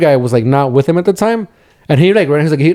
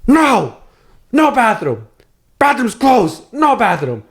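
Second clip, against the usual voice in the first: higher, curt put-on accent refusing; mock Colombian mechanic; 8 semitones higher, slower than usual. Mechanic refusing a stranger the bathroom, curt mock accent. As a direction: bark the refusal in broken English.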